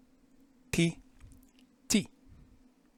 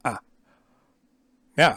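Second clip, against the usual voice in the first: ordinary talk, nothing marked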